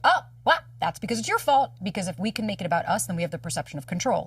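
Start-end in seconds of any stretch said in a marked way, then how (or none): 0.0-1.7 higher, louder mock-defensive inner voice; hammed-up inner protector blaming you; protector part. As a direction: snap back like a defensive inner critic. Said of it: "protector part that comes out to be like".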